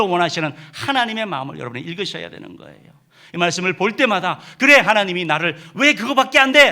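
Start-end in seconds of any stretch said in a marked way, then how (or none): 4.6-6.7 higher, louder, exasperated rebuke; mock scolding. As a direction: scold like an impatient father. The scolding we wrongly imagine.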